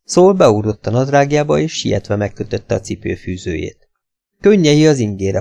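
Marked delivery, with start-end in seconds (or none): none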